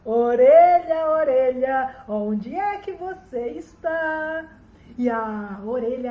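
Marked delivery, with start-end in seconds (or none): 0.0-4.5 loud, long-drawn call easing off; slow booming question to something hidden; summoning the ear. A narrator's reading. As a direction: stretch each word like an incantation.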